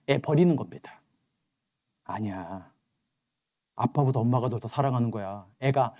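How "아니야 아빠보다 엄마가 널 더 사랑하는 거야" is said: softer, tender reassurance; calm the child down gently; gentle father. Acted. Father softly telling his daughter that mom loves her more, tender.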